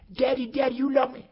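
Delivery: put-on high loud little-boy voice; high boy voice begging daddy; pleading child. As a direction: call out to daddy like a small boy. Boy asks his father.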